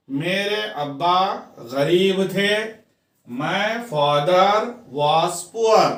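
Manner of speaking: measured, drawn-out example sentences with pauses; teacher's slow dictation; slow dictation reading. Shown as a demonstration. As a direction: read each sentence slowly for learners, word group by word group. Reads out example sentences for learners.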